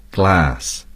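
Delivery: American English glass, with the flat a of cat; glass said the American way, short flat a; American pronunciation of glass. Shown as a demonstration. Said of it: "and Americans would say".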